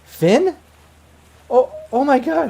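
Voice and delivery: high, loud, stunned woman's voice; shocked woman. Acted. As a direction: recognise a lost love in disbelief. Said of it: "you hear a female voice".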